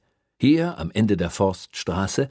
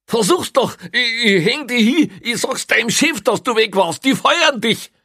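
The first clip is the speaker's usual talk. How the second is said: very high, loud, frantic yelling; furious threats; 8 dB louder than usual. A narrator's reading. Prisoner threatening to report the policeman, furious yelling. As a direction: yell threats back at full tilt.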